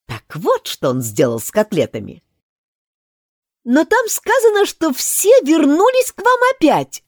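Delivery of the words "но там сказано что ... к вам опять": put-on high, loud girlish objection; Alice objecting. Alice points out the flaw to the king, high and loud. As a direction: pipe up high to correct the king. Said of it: "заметила Алиса".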